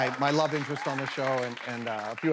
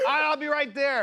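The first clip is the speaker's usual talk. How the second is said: high-pitched mock holler; calling back; 12 semitones higher, 3 dB louder, slower than usual. Actor answering fans mid-take, high calling voice. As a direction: sing out an eager reply. Acted shout back from set.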